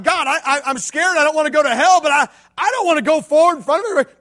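high, loud, desperate plea; pleading with God. Retold or imitated. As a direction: beg God out loud, scared. Boy bargaining with God.

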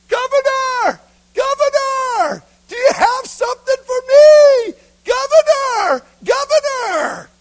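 very high, full-out drawn-out yell; yelling boy. Acted. Beggar boy calling up to the passing governor, yelling. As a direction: scream to be noticed over a crowd. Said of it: "the little boys began to yell".

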